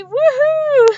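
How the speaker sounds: high, loud whoop of joy; excited cheer. Real, not acted. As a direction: cheer out loud like you just won. Cheering as viewers connect.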